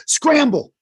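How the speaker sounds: high-pitched call to flee; mock alarm. Head of the eggnogs to the others at closing, mock alarm. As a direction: call it out high, as a sudden order.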